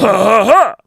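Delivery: hammed-up French chortle; mock French laugh. Shown as a demonstration. Insisting on his accent joke, exaggerated French laugh. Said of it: "that's a French person".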